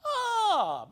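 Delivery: high, loud cry of mock delight. Eckersley spotting Bill hiding, loud delighted ah. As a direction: pounce on him with a big ah.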